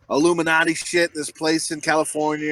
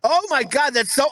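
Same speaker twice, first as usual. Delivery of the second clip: ordinary talk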